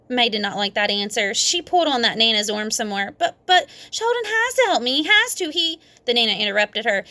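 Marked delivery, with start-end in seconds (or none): 3.2-5.8 put-on high pleading child voice; whiny little girl. A narrator's reading. May begging Nana to make Sheldon help, high whine.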